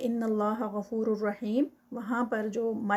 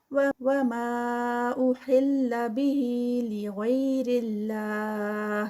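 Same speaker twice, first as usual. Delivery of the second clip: very slow, louder melodic chant; Quran recitation; 4 dB louder, much slower than usual. Shown as a demonstration. Teacher reciting the verse aloud, very slow. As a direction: stretch each long vowel fully. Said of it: "recites the verse".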